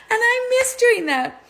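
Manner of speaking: high, loud lingering witch voice. Acted. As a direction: keep the witch voice going. Still in witch voice.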